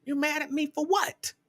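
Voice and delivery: high-pitched incredulous comeback; retold disbelief. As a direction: question her in mock bafflement, rising at the end. Retold disbelieving reply to wife.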